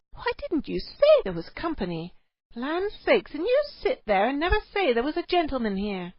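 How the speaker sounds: high fluster at her husband; embarrassed and cross at once; flustered wife. A narrator's reading. Cave wife reproaches her husband before a guest, flustered.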